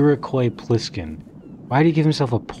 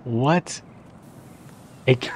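usual speaking voice

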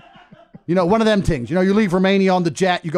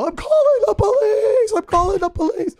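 high, shrieking mock panic; imitating a scared man screaming for the police; mock panicked scream; 5 dB louder than usual. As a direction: shriek it like a terrified man at a window. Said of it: "he starts screaming out the window".

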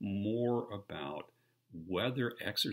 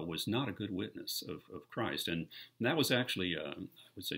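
usual speaking voice

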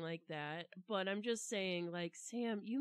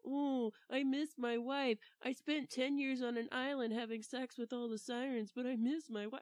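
mocking Odysseus; 6 semitones higher, 3 dB louder than usual.

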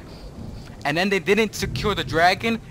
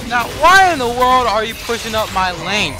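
higher, louder, fed-up complaint; exasperated rant; 7 semitones higher, 6 dB louder than usual. Real, not acted. Streamer scolding teammate Silas for pushing his lane, exasperated. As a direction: demand an answer, irritated.

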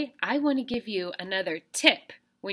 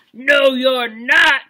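loud, slow troll growl; angry troll; 5 semitones higher, 12 dB louder, much slower than usual. Shown as a demonstration. Teacher voices the troll for kindergartners, loud and slow. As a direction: boom it out like a big angry troll. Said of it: "said the troll".

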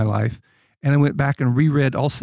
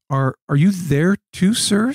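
higher, tentative boy character, lightly acted; storybook reading of a respectful query; hesitant question; 7 semitones higher, slower than usual. A narrator's reading. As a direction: ask it haltingly, rising on the last word. Edmund's timid question, read aloud.

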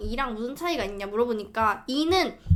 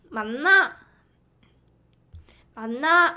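put-on Gyeongsang accent, louder question; trying out a Gyeongsang accent; 경상도 사투리로 묻기; Gyeongsang dialect; 3 dB louder than usual. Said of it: "연변 사투리 같다고요?".

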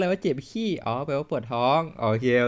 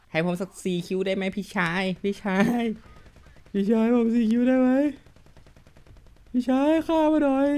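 mock begging; 8 semitones higher than usual. Gamer begs teammate for a kill, pleading higher and higher. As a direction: beg the big brother ever more shrilly.

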